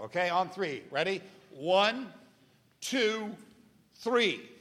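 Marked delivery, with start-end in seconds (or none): 1.5-4.5 building count, rising higher each number; counting in the crowd. Real, not acted. Host counting an audience in to shout together, building. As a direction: cue the audience on three, getting higher. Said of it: "let's try it on three".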